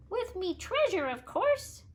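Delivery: high little leprechaun voice bargaining; pleading leprechaun. A narrator's reading. Leprechaun bargaining with Sidney, high and wheedling.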